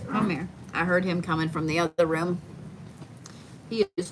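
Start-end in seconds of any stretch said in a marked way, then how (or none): 0.1-0.5 soft, coaxing call to a scared dog; calling a frightened dog softly; soothing the dog. Real, not acted. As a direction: coax him gently over.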